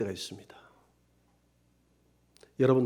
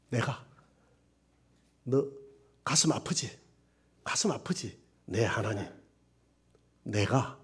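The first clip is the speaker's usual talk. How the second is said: tender voice of God; 4 semitones lower than usual. Acted. God's voice, soft and tender.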